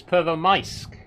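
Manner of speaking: Pervomaisk, last syllable drawn out as "mice-k"; loud, slow Pervomaisk correcting the L to an I. Shown as a demonstration. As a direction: sound the I of Pervomaisk like "eye". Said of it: "No, it's an I".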